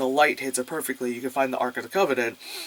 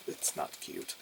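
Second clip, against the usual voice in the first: whispering; 14 dB quieter, slower than usual. Real, not acted. Deadpan aside, whispered.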